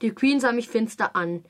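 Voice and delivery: plain reading voice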